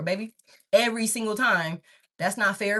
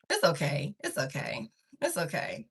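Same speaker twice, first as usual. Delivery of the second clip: soft reassuring; 7 semitones lower, 7 dB quieter than usual. Real, not acted. Soothing someone off camera.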